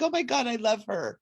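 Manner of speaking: mildly hammed-up delight; gushing. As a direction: gush it, as if recalling instant adoration. Retells her gushing first impression.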